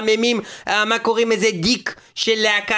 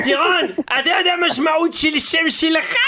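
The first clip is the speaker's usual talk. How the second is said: put-on French accent; 6 semitones higher than usual. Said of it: "לדבר במבטא צרפתי".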